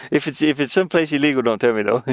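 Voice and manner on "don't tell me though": ordinary talk, nothing marked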